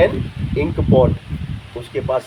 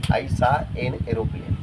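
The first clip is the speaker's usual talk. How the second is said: very slow, each word apart; slow classroom model sentence; slow dictation; 3 semitones lower, 3 dB quieter, much slower than usual. Shown as a demonstration. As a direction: say it for students to copy. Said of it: "एन aeroplane".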